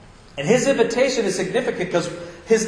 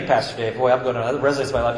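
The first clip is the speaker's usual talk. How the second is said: normal talking voice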